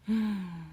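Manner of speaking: quiet, reflective sigh; soft sigh. Acted. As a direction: let out a soft breath. Pause in reflective monologue.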